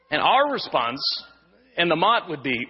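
ordinary delivery

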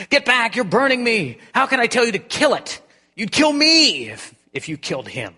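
high, loud panicked cry of pain; frantic ghost shouting to keep the angel off; ghost in agony. Acted. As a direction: shriek it out as if scorched. Ghost burning, begs angel to stop.

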